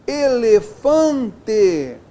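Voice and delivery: "Elefante" very slow, syllable by syllable, "fan" strong; stressed nasal syllable. Shown as a demonstration. Teacher showing a nasal tonic, "Elefante" slowly with strong "fan". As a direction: split "Elefante" and lean on "fan". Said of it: "sílaba tônica, nasal".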